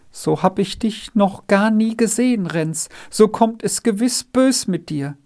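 high, plaintive reproach; lamenting. A narrator's reading. Margretli fears for her friend Renz, lamenting. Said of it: "klagte es".

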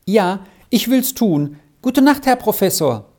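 put-on high child's voice, lightly hurried; hastily. A narrator's reading. Squirrel says good night to the professor, hastily. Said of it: "antwortete Squirrel eilig".

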